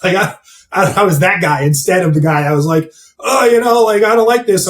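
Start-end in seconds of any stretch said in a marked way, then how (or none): 3.2-4.7 high-pitched imitation of a complainer; mock complaining. Imitating the guy who quits over a mean coach, mock complaining. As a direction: mimic a put-out trainee, pitched up.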